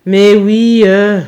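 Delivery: put-on fed-up teenage reply; annoyed sigh. A narrator's reading. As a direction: give in with exasperation. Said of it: "Soupir agacé du Jérémy".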